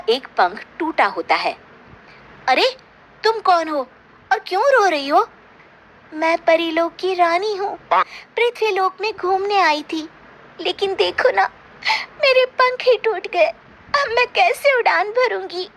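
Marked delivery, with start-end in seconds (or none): 2.5-5.3 put-on higher, gentle young girl asking; a worried girl leaning in to ask; a girl asks the crying fairy why she weeps, higher and worried; young girl's concern. Gauri finds crying fairy. 6.1-15.7 high, plaintive fairy voice, rising to a wail; a hurt fairy queen begging for pity; fairy queen tells Gauri her wings are broken, high and tearful. Acted.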